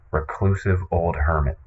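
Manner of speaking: ordinary talk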